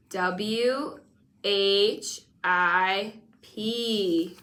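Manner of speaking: very slow letter by letter; slow spelling out. Shown as a demonstration. Teacher spelling thick slowly with the pupil. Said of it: "Now spell it".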